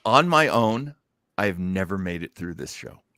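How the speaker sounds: ordinary delivery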